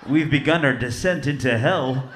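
lightly voiced smooth airline captain; a hint of a calm intercom pilot; mock pilot announcement. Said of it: "be like".

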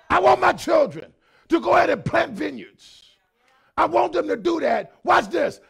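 loud, rising preacher's cadence; full-force preaching. Acted. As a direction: drive each phrase home to the congregation.

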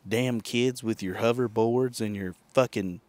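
usual speaking voice

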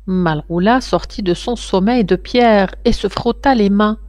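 ordinary delivery

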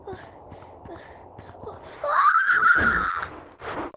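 high laughing shout mid-fall; tripping and falling. Real, not acted. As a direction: shout and laugh as you tumble. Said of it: "I tripped".

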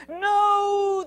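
high, loud, long no; drawn-out storybook scream of no; horrified cry. A narrator's reading. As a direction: cry out in horror as someone falls. Crowd sees him fall.

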